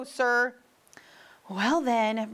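usual speaking voice

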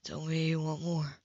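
lightly voiced lover, low and hushed; low male voice. A narrator's reading. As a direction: drop the voice low and intimate.

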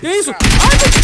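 very high, loud shocked cry; startled outcry. Real, not acted. Player suddenly caught off guard, shocked high yelp.

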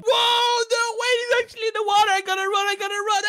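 panicked. Real, not acted. Boy chased into the water by a tiger, panicked yelling. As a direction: yell in alarm while fleeing. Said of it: "He actually screamed like a little girl".

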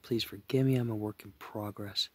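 normal talking voice

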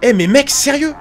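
high, loud disbelieving protest; incredulous. Real, not acted. Player to a guy in the game, loudly incredulous. Reacts indignantly to a game mishap.

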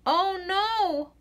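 plain reading voice

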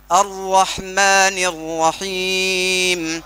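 अर्रहमानिर्रहीम drawn out very slowly, with the rr held; tajweed recitation. Shown as a demonstration. Teacher models the correct recitation for the student. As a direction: chant अर्रहमानिर्रहीम slowly, with lips flat. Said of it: "तो कोशिश करना".

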